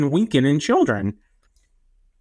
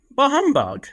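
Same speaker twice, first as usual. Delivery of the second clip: higher, lightly voiced old miser scoffing; Scrooge; 7 semitones higher than usual. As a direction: toss off a mock Scrooge grumble. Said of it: "You sound like a Scrooge".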